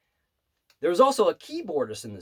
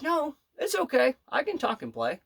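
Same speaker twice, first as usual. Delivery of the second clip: a lightly voiced high old-lady reassurance; sweet old lady; 10 semitones higher than usual. Pianist reassuring the waiter with a high light voice. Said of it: "And she's like".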